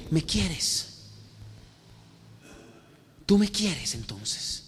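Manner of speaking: soft, slowed appeal; quiet, intimate asking after the preaching; tender question. Acted. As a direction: come down gently to the listener's level. Jesus gently coming down to Peter.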